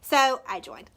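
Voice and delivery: ordinary delivery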